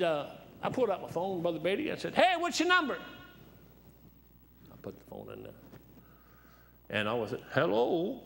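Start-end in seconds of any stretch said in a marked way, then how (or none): 2.1-3.0 high, loud call; retold holler, loud and high. Retells calling out for the number. 7.5-8.2 drawn-out phone hello, pitched up; higher-pitched old man's hello; old man answering. Retold or imitated. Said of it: "an old man".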